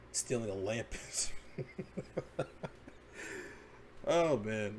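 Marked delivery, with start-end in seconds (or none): 0.9-3.6 high, breathy fit of laughter; real, helpless giggling; laughing. Real, not acted. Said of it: "I shouldn't be laughing".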